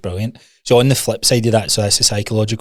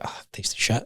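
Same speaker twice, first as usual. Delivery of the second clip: breathy whisper of letdown; whispered disgust; 11 dB quieter than usual. Retold or imitated. Friend retelling a disappointing treat, whispered. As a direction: whisper it like a let-down.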